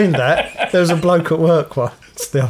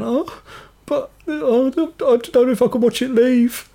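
imitating crying woman; 9 semitones higher than usual. Mimicking the bereaved woman watching mum's car go, mock crying. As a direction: falter through it in a high, put-on tearful voice. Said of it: "she's there crying".

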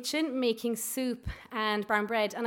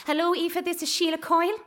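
a higher, louder bossy headmistress greeting; imitating a loud, brisk Irish school principal; loving but aggressive principal; 8 semitones higher, 6 dB louder than usual. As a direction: play the stern school principal on the phone. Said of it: "in the most loving and aggressive way ever".

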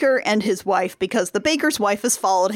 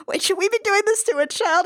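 worried baker; 10 semitones higher than usual. Retold or imitated. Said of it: "the one who's like".

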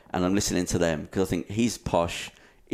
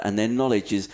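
ordinary talk, nothing marked

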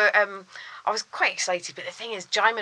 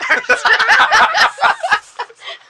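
high, loud peal of laughter; helpless laughter; 8 dB louder than usual. Real, not acted. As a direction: burst out laughing at your own embarrassment. Laughing at her own retold embarrassment.

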